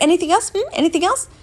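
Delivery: high, impatient mock doctor; rushed doctor. Patient mimics the rushing doctor cutting her off, high and impatient. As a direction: hurry her along, pitched high. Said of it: "she kept cutting me off".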